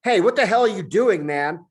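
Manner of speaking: high, loud, startled monkey voice; a chained monkey yelling in protest; talking monkey. Acted. Talking monkey shouts at Julian, high and loud. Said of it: "said the talking monkey".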